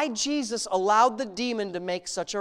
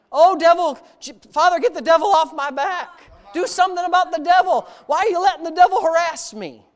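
much higher and louder, whiny begging prayer; mock pleading believer; 8 semitones higher, 9 dB louder than usual. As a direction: parody a believer nagging God about the devil.

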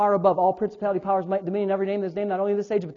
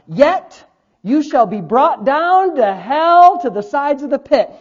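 slow, emphatic pronouncement of doom; declaimed scripture; 6 semitones higher, 7 dB louder, much slower than usual. Real, not acted. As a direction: pronounce the verse like a sentence passed.

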